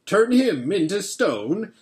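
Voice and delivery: lightly voiced beaver, amused scorn; scoffing Beaver. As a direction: scoff at the very notion.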